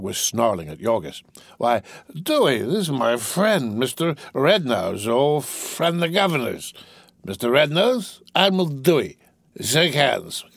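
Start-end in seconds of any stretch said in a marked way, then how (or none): 1.6-10.4 slow, slurry formal introduction; tipsy toff drawing out his words; tipsy young master. Acted. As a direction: introduce them with woozy, drawn-out ceremony.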